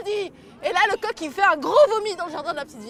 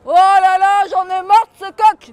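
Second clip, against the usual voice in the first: loud fed-up groan of a king; the king has had enough; exasperated king; 9 dB louder than usual. Acted. The king complains about the rooster, loud and exasperated. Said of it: "dit le roi".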